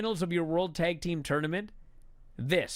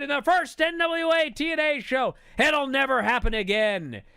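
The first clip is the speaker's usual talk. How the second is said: loud, high aggrieved promo; Jeff Jarrett ranting; 10 semitones higher, 6 dB louder than usual. Retold or imitated. Wrestler vowing revenge in the ring, loud aggrieved rant. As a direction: vow it in a raised, heated voice.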